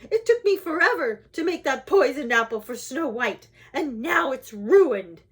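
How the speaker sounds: put-on old lady shrieking in outrage; screeching. A narrator's reading. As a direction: rant furiously, slowing on the final words. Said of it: "screeched the old lady".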